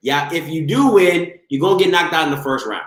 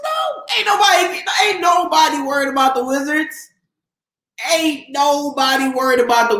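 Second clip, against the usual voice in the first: mocking disbelief; 8 semitones higher than usual. Real, not acted. Streamer laughing off a Wizards fan, high mocking yell. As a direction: scoff at the idea in a high shout.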